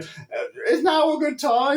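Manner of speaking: high, unsure little question; high, hesitant imitation of a nervous friend; timid Brenda. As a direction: ask meekly as if interrupting. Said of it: "she'll be going".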